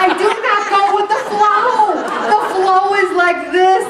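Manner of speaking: high, fast, laughing admission. Real, not acted. Confessing to the church, laughing and fast. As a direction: blurt it out through laughter. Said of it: "laughing self-confession".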